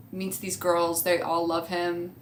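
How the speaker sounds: ordinary talk, nothing marked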